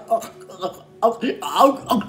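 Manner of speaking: strangled gulping noises; imitated choking. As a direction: gag as if being throttled mid-prayer. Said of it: "bả cứ ực ực vậy đó".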